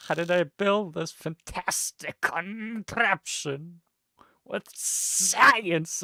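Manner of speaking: high, loud mad inventor; cackling mad scientist, high and loud; crazy architect voice. Acted. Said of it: "I'm crazy".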